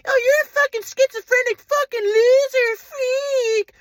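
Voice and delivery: high, slow, tearful mock of parents; crying insult. Son mocking what his parents call him, crying. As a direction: wail the insult through tears, slow and high.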